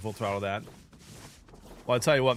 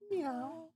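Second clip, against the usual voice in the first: high soft meow; 13 semitones higher, 11 dB quieter than usual. Real, not acted. Answers for the baby.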